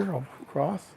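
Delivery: ordinary delivery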